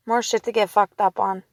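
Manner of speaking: ordinary talk, nothing marked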